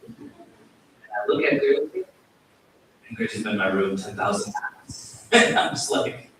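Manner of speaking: usual speaking voice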